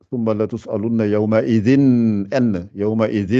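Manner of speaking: يومئذ with a distinct n sound, no nasal merging; izhar, clear n. Shown as a demonstration. Teacher demonstrating clear nun without ghunna. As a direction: say the n of يومئذ clearly before the next word. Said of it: "je fais pas de runna".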